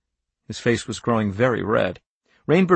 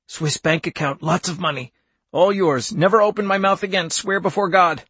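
higher, urgent bargaining that speeds up; desperate old man begging, pitched up; frantic whispered plea; 7 semitones higher than usual. A narrator's reading. Wanless begging Rainbird for his life, frantic plea. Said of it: "he whispered very fast".

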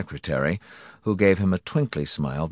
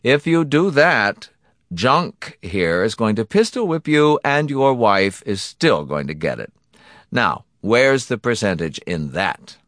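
slightly raised, reasoning threat; annoyed villain; 5 semitones higher, 5 dB louder than usual. Acted. Donati warning his victim off, lightly annoyed. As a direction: warn him as if stating simple logic.

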